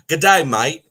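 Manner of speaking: loud, high Australian greeting; mock Australian accent. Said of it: "You were like an Australian southerner".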